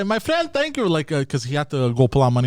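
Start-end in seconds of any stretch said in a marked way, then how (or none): 0.0-0.9 imitating mom. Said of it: "my mother yells out to the Armenian guy".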